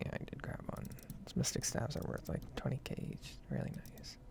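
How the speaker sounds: ordinary talk, nothing marked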